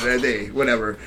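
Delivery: ordinary talk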